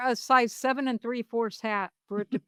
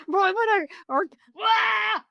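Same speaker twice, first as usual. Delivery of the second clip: squealing laugh, much higher; shrieking laughter mid-sentence; 8 semitones higher than usual. Real, not acted. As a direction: laugh high like a monkey. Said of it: "That sounds like monkey".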